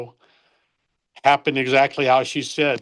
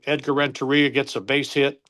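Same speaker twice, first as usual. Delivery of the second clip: ordinary talk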